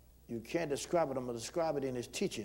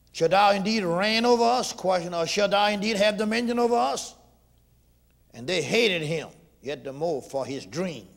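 preaching; 7 semitones higher, 9 dB louder than usual. Acted. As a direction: proclaim Joseph's dream to the church. Preaching scripture.

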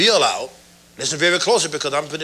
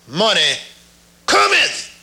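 "cometh" shoots up high, then falls; third proclamation; 4 semitones higher, 4 dB louder, slower than usual. Retold or imitated. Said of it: "And then I said the third time".

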